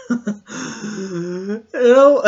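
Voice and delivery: high squeal of helpless laughter; laughing fit. Real, not acted. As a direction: crack up completely.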